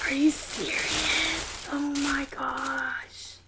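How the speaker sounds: slow, hushed "Oh my gosh"; whispered wonder; awed whisper. Real, not acted. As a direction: breathe it out in awe.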